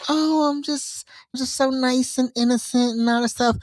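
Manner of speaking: high, sugary innocent act; mock-innocent high voice; innocent sweet voice. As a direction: play the sweet little angel. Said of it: "she had the perfect switch up".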